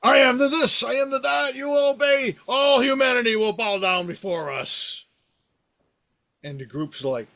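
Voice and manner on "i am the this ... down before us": high, loud ranting mouse overlord; villain mouse ranting. Retold or imitated. Hitler Mouse declaring rule over humanity, high ranting shout. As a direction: shout the tyrant's decree up high. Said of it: "he's like, and it's very much just".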